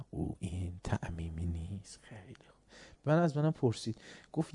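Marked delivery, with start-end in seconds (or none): 0.1-2.0 slow whispered reply, barely audible; hushed whisper. Retold or imitated. Father quietly correcting his son, whispered. 2.0-2.6 whisper. Real, not acted. Aside after father's quote.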